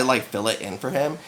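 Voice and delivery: normal talking voice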